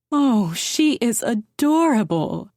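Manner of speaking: cooing. A narrator's reading. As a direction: coo it like a doting grandmother. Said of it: "Jacqueline cooed".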